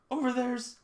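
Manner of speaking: high, slow exaggerated cry; mock outraged girl. Reading girl's line as dub, high exclaim. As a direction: call it out in mock alarm. Voicing character calling out.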